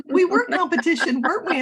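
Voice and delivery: tickled, laughing protest. Real, not acted. Joking about being competitors.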